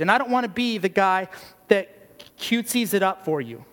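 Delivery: ordinary talk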